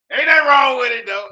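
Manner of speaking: loud joking shout over the call; loud teasing. Real, not acted. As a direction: call it out loudly as a tease. Teasing about the computer.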